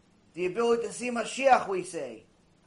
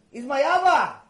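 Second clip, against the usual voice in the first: put-on higher, louder childlike declaration; little boy's proud reveal; 4 semitones higher, 6 dB louder than usual. Boy reveals captain is his father.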